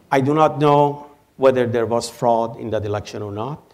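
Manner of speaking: ordinary talk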